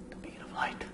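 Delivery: breathy mock-mysterious whisper; dramatic whisper. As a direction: hush it like an omen. Dramatic whisper while playing.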